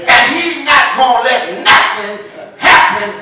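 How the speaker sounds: very high, loud, sung-out preaching; preaching whoop. Real, not acted. Preacher whooping to the congregation about the Lord. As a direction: whoop it to the rafters.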